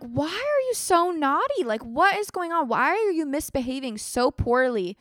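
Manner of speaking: put-on exasperated parent questioning the kid, half-playful; mock scolding parent. Parent scolding naughty child.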